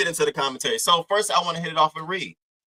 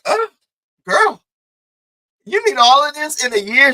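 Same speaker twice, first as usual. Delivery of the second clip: put-on high, loud disbelieving "girl, you need all this"; incredulous; 13 semitones higher, 9 dB louder than usual. Asking the woman in disbelief, high and loud. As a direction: voice jumps up in disbelief. Incredulous reply to big ask.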